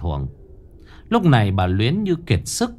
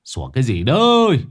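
Ông Lưu; 3 semitones higher, 3 dB louder than usual. A narrator's reading. Husband indoors yelling out at the barking dog. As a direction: holler it out, swelling on the end. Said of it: "mà quát vọng ra".

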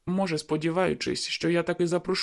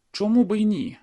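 higher, louder sudden hope; hopeful outburst; 6 semitones higher, 6 dB louder than usual. A narrator's reading. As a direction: burst out with a flash of hope.

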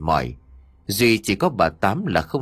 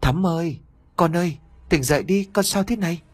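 crying out a name; 7 semitones higher than usual. A narrator's reading. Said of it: "đang khóc lóc gọi tên của cô".

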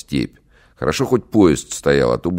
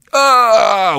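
pained scream; 7 dB louder than usual. Acted. Man bitten, screams in pain.

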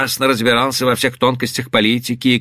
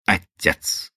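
decisive tone; 7 semitones lower, 6 dB quieter than usual. Acted. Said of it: "решительным тоном прервала его Эйлин".

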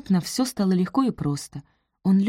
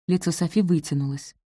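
ordinary delivery

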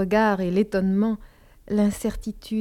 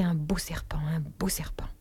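breathy repeated whisper to herself; 4 semitones lower, 9 dB quieter than usual. A narrator's reading. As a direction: whisper it over and over, haunted. Narrator repeats phrase to herself.

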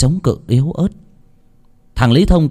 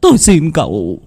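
higher, louder, anguished pleading; desperate begging; 10 semitones higher, 4 dB louder than usual. Acted. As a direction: beg as if life depends on it. Husband begs for his wife. (van xin tuyệt vọng, giọng cao và to)

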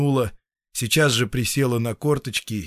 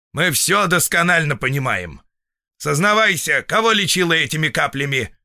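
raised, forceful demand; stern interrogation; 5 semitones higher, 4 dB louder than usual. A narrator's reading. Feldsher demanding patient confess whom she treated, stern. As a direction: press her for a confession.

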